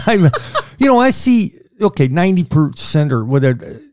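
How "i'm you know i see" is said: high-pitched laugh through the words; giggling mid-sentence. Real, not acted. Laughing while talking.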